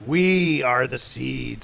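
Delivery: slow, loud creature voice; loud eerie proclamation; plant creature chorus. Acted. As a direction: stretch each word out forcefully. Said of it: "says another one over by".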